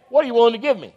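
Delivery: light mock of a greedy asker; a hint of a grasping demand; selfish believer. People approaching Jesus selfishly, mock greedy ask. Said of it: "Some people approach Jesus the same way".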